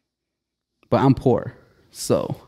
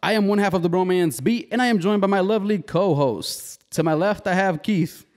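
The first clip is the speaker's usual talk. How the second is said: hype host intro; 7 semitones higher than usual. Acted. Hyped podcast intro.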